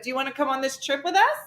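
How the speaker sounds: high, fast, eager invitation; rising, wheedling offer to take the picture; eager stranger. Retold or imitated. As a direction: coax them into the photo. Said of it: "They'd be like".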